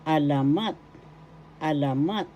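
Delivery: "alamat" as a-la-MAT; last-syllable stress. Shown as a demonstration. Teacher shows where the accent is, "alamat" stressed on mat. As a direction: land hard on "mat" in "alamat". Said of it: "The third syllable has the accent".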